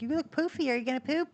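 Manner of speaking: high, sing-song coo to a pet. Real, not acted. To her cockatiel on camera, high cooing pet talk. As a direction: coo up at the little bird.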